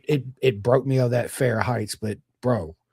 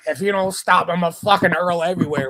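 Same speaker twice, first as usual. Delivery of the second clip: higher and louder, pleading him to stop; near-sick yell at the instructor; desperate warning; 4 semitones higher, 6 dB louder than usual. Retold or imitated. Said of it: "I was like".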